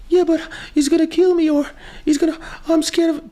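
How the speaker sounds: high, fast frightened woman's voice; scared ex. Pretend. The frightened ex tells the lawyers she's scared, high and fast. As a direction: squeak it out like a scared ex-wife.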